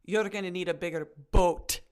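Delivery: "boat" ending on a hard, exploded T; hammered final T. Retold or imitated. Imitating Adam saying the film line, over-hit T in "boat". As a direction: beat the T at the end of "boat". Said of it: "beat the fuck out of that T".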